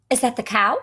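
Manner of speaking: ordinary delivery, nothing marked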